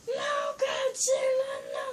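high, slow, drawn-out wail; mock-dramatic plea in a squeaky voice; acted cry of alarm. Skit character to Godzilla, wailing high.

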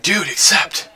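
loud urgent yell; impatient shout. Real, not acted. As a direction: yell at your teammate to hurry. Urging teammate loudly.

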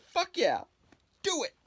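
high, loud cheer; tear ducts cheering. Pretend. His tear ducts cheering him on, high and loud. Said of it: "That was my impression of my tear ducts".

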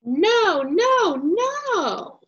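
high, loud whiny refusal; a toddler shouting no at bedtime; child's loud no. Acted. Toddler protesting bedtime, loud high no.

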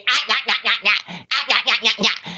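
mock repeating nah nah nah; mocking babble. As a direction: parody their empty yapping again. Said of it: "don't even know if you even have a fucking vocabulary".

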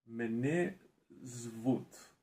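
halting, word by word English-accented Ukrainian. Real, not acted. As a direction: sound out each word like a beginner. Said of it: "So, read, please".

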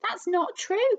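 high, loud protest; a wife's indignant high-pitched cry of denial. A narrator's reading. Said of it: "cried Missus Twit".

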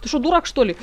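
usual speaking voice

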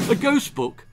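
high, loud, excited question; eager child. A narrator's reading. Child interrupting grandma's story, eagerly asking. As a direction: pipe up high and keen.

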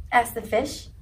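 ordinary delivery

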